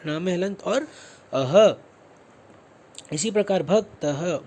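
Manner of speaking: ordinary delivery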